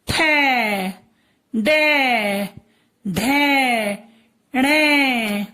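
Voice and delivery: ठै, डै, ढै, णै each held long and falling; drawn-out syllable drill. Shown as a demonstration. Teacher drilling the matra row, drawn-out syllables. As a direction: chant each syllable separately, vowel stretched. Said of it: "ठ में लगी तो".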